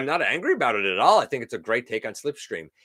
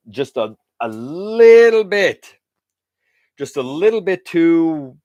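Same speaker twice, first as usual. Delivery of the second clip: louder, slow, stretched hedge; drawn-out hesitation; 5 semitones higher, 10 dB louder, slower than usual. Real, not acted. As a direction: drag it out, weighing it. Hesitating over a figure's bulk.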